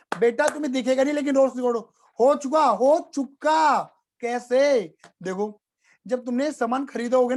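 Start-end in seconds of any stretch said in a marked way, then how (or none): 2.2-3.9 slow, drawn-out, firm repetition; emphatic insistence. Shown as a demonstration. Teacher insisting to students the loss is already recorded, slow and firm. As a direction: drive it home word by word.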